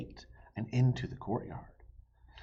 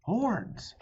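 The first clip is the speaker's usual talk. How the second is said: excited Annie; 11 semitones higher, 5 dB louder than usual. Acted. Annie excited, hearing horns.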